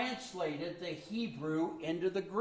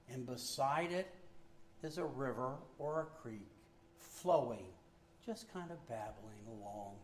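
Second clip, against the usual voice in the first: calming guided imagery; 5 semitones lower, 9 dB quieter than usual. Shown as a demonstration. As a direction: lower and softer, guide them into calm. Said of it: "You want to calm your heart sometime?".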